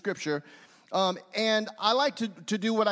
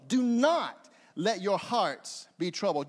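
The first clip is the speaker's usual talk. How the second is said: "not" pitched up, followed by a pause; stress on NOT; 2 semitones lower than usual. Shown as a demonstration. Preacher showing a new truth per word, stress on "not". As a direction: punch "not" and let it hang. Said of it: "when you emphasize a different word".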